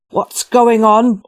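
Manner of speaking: slow, loud angry hiss. Acted. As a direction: hiss it out with menace. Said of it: "hissed a new voice".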